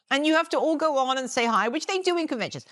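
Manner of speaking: ordinary talk, nothing marked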